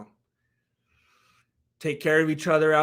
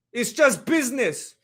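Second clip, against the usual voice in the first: higher-pitched, badly faked Conor accent; attempted Conor accent; 5 semitones higher than usual. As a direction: try a Conor accent and not quite land it. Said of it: "I can't do a Connor accent".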